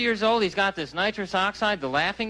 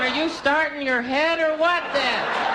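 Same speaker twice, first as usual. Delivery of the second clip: teasing son; 7 semitones higher, 3 dB louder, slower than usual. Retold or imitated. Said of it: "I'm going".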